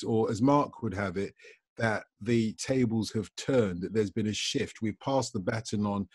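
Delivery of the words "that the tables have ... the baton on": normal talking voice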